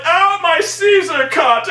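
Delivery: high, loud, slow hammed-up wail; mocking Zod mourning his hair, high and loud; mock whining Zod. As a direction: wail about the haircut.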